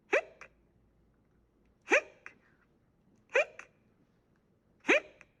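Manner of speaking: soft hiccup squeaks; hiccuping hippo. A narrator's reading. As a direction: hiccup between tries. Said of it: "I have hiccups".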